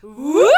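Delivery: loud high whoop call. Real, not acted. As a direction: belt out a sound cue. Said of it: "you have to make the sound".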